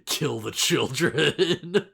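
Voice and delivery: drawn-out mock villain command; slow, theatrical villain line; Leomon impression. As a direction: stretch it slowly into a hammed-up evil order. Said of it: "he's just like".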